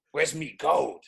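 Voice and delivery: hammed-up Irish pirate accent; playful mock Irish brogue. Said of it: "I just gave him an Irish accent".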